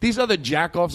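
normal talking voice

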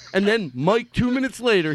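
usual speaking voice

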